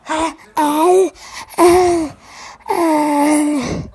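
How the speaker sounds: loud retching uh sounds; loud gagging groans. Real, not acted. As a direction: gag loudly at a bad taste. Gagging after tasting.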